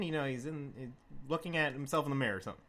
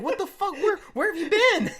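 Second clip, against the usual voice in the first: much higher, louder incredulous yell; shocked yell; 8 dB louder than usual. Retold or imitated. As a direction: yell at your missing friend. Narrator yelling at drunk friend.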